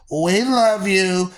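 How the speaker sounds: ordinary talk, nothing marked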